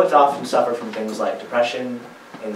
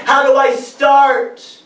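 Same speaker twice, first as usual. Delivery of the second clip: high, loud, slow cry of frustration; frustrated yell; 13 semitones higher, 7 dB louder, much slower than usual. Retold or imitated. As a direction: yell it out, stretched and fed up. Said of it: "I yelled and spat my frustrations".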